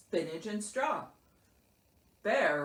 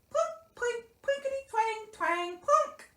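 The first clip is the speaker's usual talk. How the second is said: high, plucky twangs and plunks; tiny banjo imitation; 4 dB louder than usual. A narrator's reading. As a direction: voice a mouse's little banjo. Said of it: "strummed heartily on a tiny banjo".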